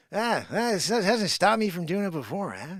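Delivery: ordinary delivery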